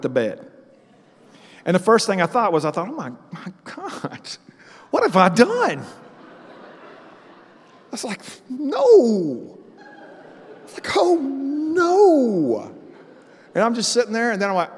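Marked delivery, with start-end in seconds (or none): 2.9-5.8 mock horror. Man wakes to find his bed wet, mock horror. 8.5-9.6 long, high, loud "no"; hammed-up drawn-out cry of denial; mock dismay. Man realizes he may have wet the bed, mock dismay. 11.0-12.7 very high, drawn-out comic wail; hammed-up moan of horror swelling on the "no"; mock wail. Man dreads what his roommate will think, mock wail. Reliving dread at the wet bed.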